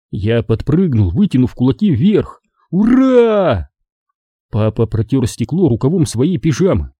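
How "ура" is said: high, whooping hurrah; jubilant cheer. A narrator's reading. Boy jumps with fists up, jubilant cheer. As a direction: cheer in triumph.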